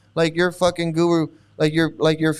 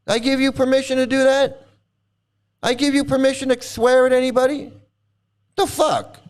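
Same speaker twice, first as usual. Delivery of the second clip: higher, pressing outrage at the chatter; indignant anger; 7 semitones higher than usual. Real, not acted. Streamer berating a chatter for swearing at his people. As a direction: scold them for daring to swear.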